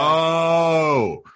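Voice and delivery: long drawn-out oh of discovery; exaggerated dawning recognition; mock realization. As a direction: let the penny drop slowly. Acts out moment of realization.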